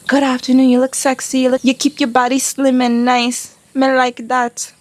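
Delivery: lightly voiced sleazy officer; mock leering catcaller. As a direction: imitate a crude policeman. Voicing the officers' vulgar remarks.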